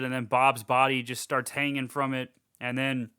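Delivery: ordinary talk, nothing marked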